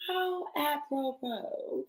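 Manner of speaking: soft, high, drawn-out musing; wry retold reaction. Mother retelling reaction to divorce date, wry and drawn out.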